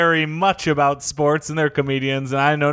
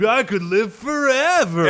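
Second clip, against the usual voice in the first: stoner voice; 5 semitones higher, slower than usual. Retold or imitated. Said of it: "They're like".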